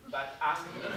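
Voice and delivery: normal talking voice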